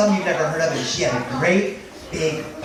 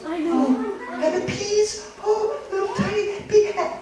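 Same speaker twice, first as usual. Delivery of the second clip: very high, little squeaky marveling; high-pitched delight at something small; tiny-voiced wonder. Tiny mouse marvels at the peas.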